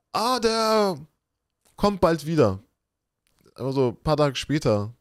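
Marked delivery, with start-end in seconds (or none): 0.1-2.6 high, singsong brush-off; beschwichtigende Verwandte. Retold or imitated. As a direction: imitate relatives airily fobbing off a child, pitched up. Voices relatives answering the child. (hoch und beschwichtigend nachgemacht)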